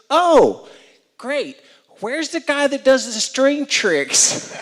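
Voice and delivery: high, loud mock voice, slow; high-pitched playful impression, dragged out; imitating Sarah. Sarah asking for the string-trick guy, high mock voice. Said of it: "She goes".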